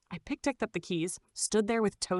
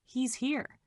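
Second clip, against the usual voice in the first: soft, higher, trembling with fear; shaky frightened voice; 6 semitones higher, 4 dB quieter than usual. Acted. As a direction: barely get the words out, terrified. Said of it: "Then a shaky voice through the floorboards".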